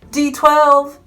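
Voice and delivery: high, loud announcement; excited shout. Real, not acted. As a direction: shout the big reveal. Excited reveal of pickup area.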